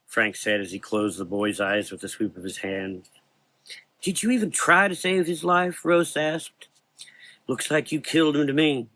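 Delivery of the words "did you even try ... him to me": high, accusing Southern woman. Acted. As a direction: call him out. Rose accuses.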